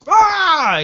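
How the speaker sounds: high wordless shriek; panicked scream. Retold or imitated. Manager screaming down the phone in alarm. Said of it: "He just screamed".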